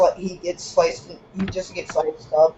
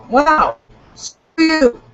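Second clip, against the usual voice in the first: shouting; 7 semitones higher, 5 dB louder, much slower than usual. Real, not acted. Outburst at the stuck stream.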